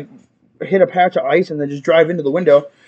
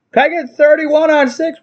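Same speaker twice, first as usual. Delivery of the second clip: high-pitched raised voice of a headphone wearer; imitated yelling; 10 semitones higher than usual. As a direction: call it out as if you can't hear yourself. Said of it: "Why the hell are you yelling?".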